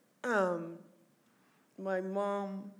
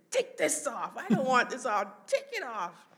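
loud, raised retold orders; imitated insistent demand; 5 semitones higher, 9 dB louder than usual. Mom ordering oxygen off her, mock angry demand. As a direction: act out a patient refusing, loud and insistent.

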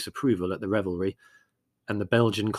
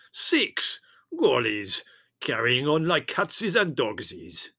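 slowing, higher-pitched scorn in a character voice; disgusted; 3 semitones higher, slower than usual. A narrator's reading. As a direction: draw out your outraged disgust. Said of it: "said Spiro in disgust".

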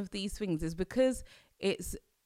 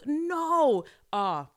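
normal talking voice

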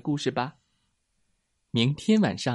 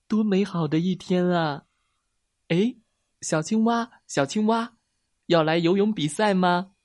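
higher-pitched turtle, delighted with the day; friendly little turtle; 6 semitones higher, 4 dB louder than usual. Acted. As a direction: call out brightly to invite a friend. (小乌龟兴致勃勃地高声招呼)

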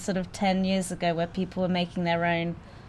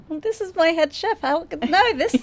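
surprised; 11 semitones higher, 4 dB louder than usual. Retold or imitated. Retelling own nervous reaction.

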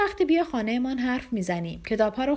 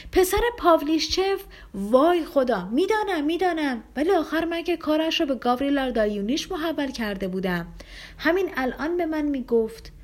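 upset cry; 7 semitones higher than usual. Acted. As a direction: cry out in dismay, voice leaping up. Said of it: "پرنس بسیار ناراحت شد و فریاد زد". (فریاد ناراحت و بلند، با صدای خیلی زیر)

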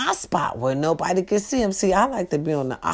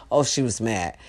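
ordinary talk

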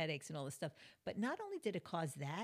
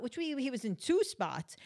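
ordinary delivery

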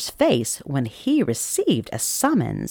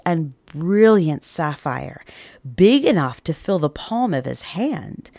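ordinary delivery, nothing marked

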